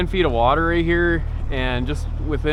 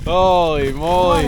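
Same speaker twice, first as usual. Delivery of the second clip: amazed; 5 dB louder than usual. Real, not acted. Seeing the huge fish.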